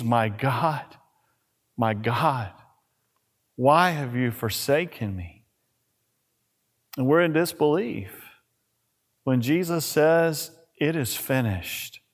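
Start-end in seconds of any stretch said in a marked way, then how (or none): 0.0-5.4 anguished cry. Retold or imitated. Jesus' cry from cross quoted. 10.8-12.0 low, much softer, nearly spent; hushed last words. Retold or imitated. Jesus' last words on cross.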